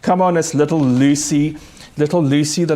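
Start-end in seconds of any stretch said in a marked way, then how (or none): none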